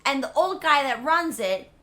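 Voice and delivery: usual speaking voice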